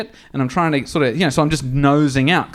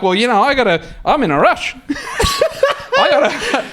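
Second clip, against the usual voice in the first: laughing; 9 semitones higher than usual. Retold or imitated. Brushing off the bystander about the gas leak, laughing. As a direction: laugh through the excuse. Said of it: "I'm laughing".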